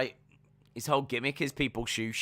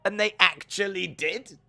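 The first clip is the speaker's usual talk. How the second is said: mock disbelief; 9 semitones higher than usual. Acting out disbelief at crowd's silence.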